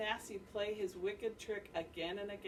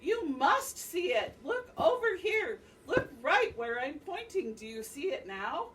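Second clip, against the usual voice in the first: angry spider; 7 semitones higher, 6 dB louder than usual. Acted. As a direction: lose patience, stabbing each word. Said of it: "Ananasi began to get angry".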